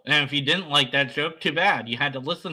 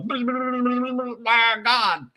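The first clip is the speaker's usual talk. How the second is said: mock awed; 8 semitones higher than usual. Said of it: "where you just go".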